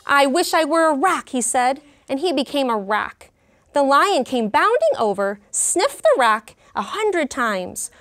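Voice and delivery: lively, expressive storybook reading; reading to children. Acted. Teacher reading a picture book to her class, lively storytelling. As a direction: bring the story alive for the class. Story read-aloud continues.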